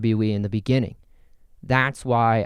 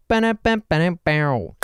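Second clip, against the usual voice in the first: higher, punchy vocal drum fill; playful rimshot noise; mouth rimshot; 5 semitones higher, 3 dB louder than usual. Acted. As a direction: sound out a rimshot. Rimshot after his joke.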